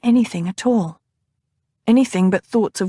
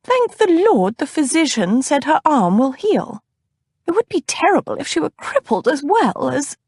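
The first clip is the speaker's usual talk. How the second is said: put-on high older lady voice trailing off; worried fussy cousin; 8 semitones higher than usual. A narrator's reading. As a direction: let the cousin's worry cut her off. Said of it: "Batilda's voice died away".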